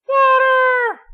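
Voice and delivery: a sudden high yelp, loud and raw; panicked shout. Acted. Cat god yelping as snowflakes melt on him, shrieking. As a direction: shriek it like a cat hit by water. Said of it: "running in circles and screaming".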